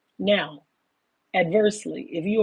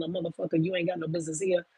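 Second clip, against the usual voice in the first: usual speaking voice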